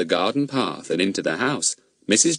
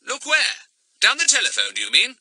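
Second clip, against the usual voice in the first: inquiring. A narrator's reading. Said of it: "said Fatty inquiringly".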